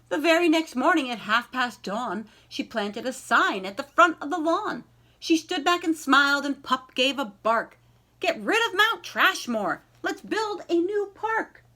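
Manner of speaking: lively, sing-song picture-book reading; story narration. A narrator's reading. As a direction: read it like a bedtime rhyme to a class. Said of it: "reads picture book aloud".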